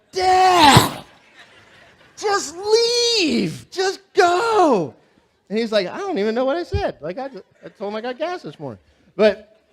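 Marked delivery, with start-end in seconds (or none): 0.1-5.0 much higher, much louder pleading shout; hammed-up mortified girls begging; mortified daughters' yell. Daughters beg dad to drive off, mock crying shout. 5.9-8.8 confused dad. Retold or imitated.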